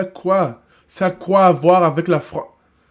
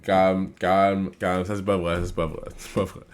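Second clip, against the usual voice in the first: calming down; 11 semitones lower, 8 dB quieter than usual. Real, not acted. Calming himself after outburst.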